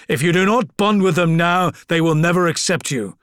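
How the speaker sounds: loud gruff commanding lord; stern commanding father. A narrator's reading. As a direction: order him like a wounded warlord. Father commanding his son.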